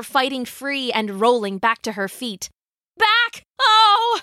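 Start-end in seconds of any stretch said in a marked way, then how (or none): 3.0-4.2 high, loud, short cries; battle shout. A narrator's reading. Lydia driving a lion back, shouting. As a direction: fend off a beast with sudden yells.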